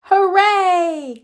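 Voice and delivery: high, joyful cheer; happy shout of hooray. A narrator's reading. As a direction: cheer like kids at big news. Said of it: "cried Franklin and Bear".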